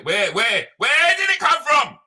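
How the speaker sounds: building to a high, loud, slow shout on "where did it come from"; exasperated shout. Real, not acted. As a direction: yell it out in disbelief. Exasperated rant about the scene.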